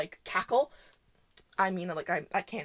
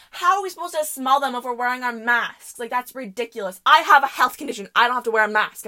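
mock outraged yell, getting louder; imitated yelling; 3 semitones higher, 7 dB louder than usual. Girl arguing with worker about masks, imitated yelling. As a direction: imitate an indignant girl, punching louder as it goes. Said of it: "she's still yelling".

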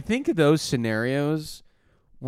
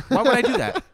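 laughing; 11 semitones higher, 8 dB louder than usual. Retold or imitated. Retelling a cop stop, laughing.

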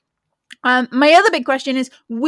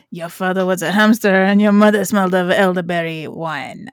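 lower-pitched French taunting voice; mock French accent; 4 semitones lower than usual. Host imagining French Drow insults, mock French accent. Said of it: "All I'm imagining now is".

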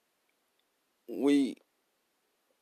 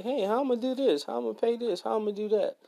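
worried; 10 semitones higher, faster than usual. Retold or imitated. Worried self quoted.

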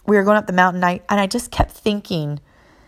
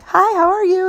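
cheery greeting; 12 semitones higher than usual. Retold or imitated.

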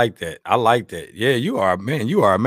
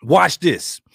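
ordinary delivery

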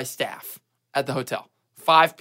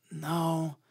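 quiet denial; 11 dB quieter than usual. Retold or imitated. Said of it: "I go".

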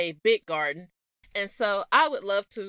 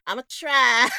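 co-host's Southern twang, louder, half-laughing; imitated Southern accent; 6 dB louder than usual. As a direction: mimic her Southern way of saying "try". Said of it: "I can't say it like you".

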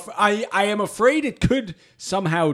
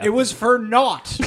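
ordinary talk

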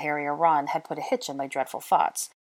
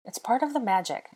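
higher-pitched insistence; insisting; 7 semitones higher than usual. A narrator's reading. She insists he should sing, higher and earnest. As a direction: insist on it, pleading your case. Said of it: "I insisted".

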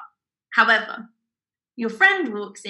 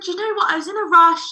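high, excuse-making retelling; raised-pitch voice of a caught-out shopper; flustered customer; 8 semitones higher, 4 dB louder than usual. Retold or imitated. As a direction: imitate a lady hurriedly explaining herself. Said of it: "she said".

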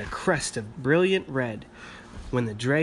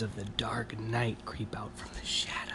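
spooky hushed whisper; 9 dB quieter than usual. A narrator's reading. Reader hushes the monster part for child, whisper. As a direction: breathe it like a secret.

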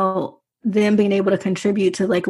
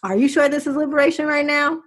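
son's voice pitched higher, mock-accusing; teasing son; 6 semitones higher than usual. Son calling out his mother in a higher, teasing voice. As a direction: put on a higher, teasing kid's challenge. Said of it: "he be like".

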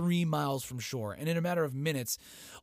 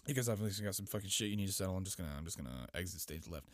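lower, softer and faster, slipping away; sneaky Cersei exit; 5 semitones lower, 7 dB quieter, faster than usual. Retold or imitated. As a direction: mumble it while edging out the door. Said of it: "scoots past him, like".

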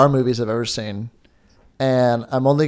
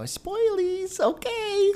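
put-on much higher, slow, cutesy baby voice; cutesy squeak; 8 dB quieter, much slower than usual. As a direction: tease it like a squeaky cartoon kid. Playful jab about spoilers.

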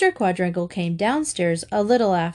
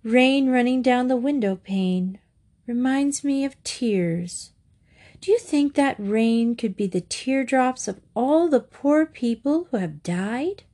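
dreamy; 6 semitones higher than usual. A narrator's reading. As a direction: drift through it wistfully. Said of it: "a dreamy, faraway look".

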